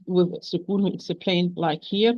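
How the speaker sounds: ordinary delivery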